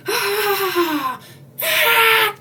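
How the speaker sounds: high, loud cries of fright; mock screams. Shopper fleeing the knife, high screams. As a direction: scream while running off.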